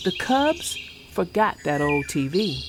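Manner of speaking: put-on gentle rhyming read-aloud for kids; storybook reading. A narrator's reading. Vlogger reading a bedtime rhyme to kids, storybook voice. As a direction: read the picture book to young viewers. Reads picture book aloud.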